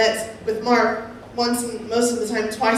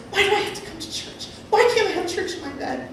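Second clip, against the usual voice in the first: arguing with God; 8 semitones higher than usual. Retold or imitated. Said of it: "I would argue with God".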